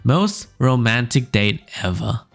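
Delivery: normal talking voice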